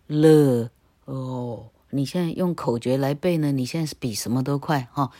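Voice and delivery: ordinary talk, nothing marked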